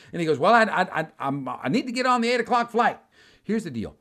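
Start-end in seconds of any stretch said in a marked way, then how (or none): 0.4-3.0 flustered insistence. Retold or imitated. Joe stammering his demand.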